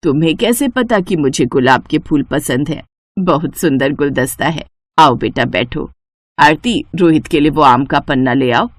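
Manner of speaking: mother-in-law greeting the neighbour with flowers. Acted. Shobha welcomes Rohit.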